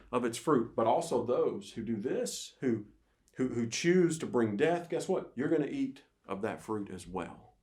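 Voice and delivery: normal talking voice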